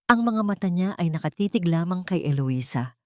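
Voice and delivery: ordinary delivery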